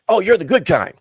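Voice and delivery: loud, high imitation of gushing reassurance; exaggerated, hurried-to-placate mimicry; mock backpedalling. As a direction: blurt it out high, mimicking her turnaround. Imitating the woman's sudden backtrack.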